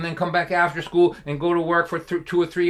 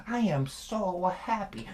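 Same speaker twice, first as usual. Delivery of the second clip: mock-sincere; 2 semitones higher, 5 dB quieter, slower than usual. Dad's mock-sincere guilt trip.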